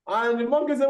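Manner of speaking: ordinary talk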